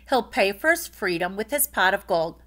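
ordinary delivery

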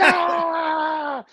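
high hyped crowd yell; imitating the crowd's roar; crowd shout. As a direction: belt it like the whole room just erupted. Crowd erupting at the burn.

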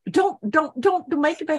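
high laughing don't; laughing plea. Real, not acted. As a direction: beg through a laugh.

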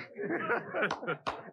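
a big high burst of laughter. Real, not acted. Laughing in the chamber.

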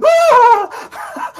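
high, loud shriek; startled scream. Acted. Captured performer shrieking when grabbed from behind. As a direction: yelp as she's grabbed from behind. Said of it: "she goes".

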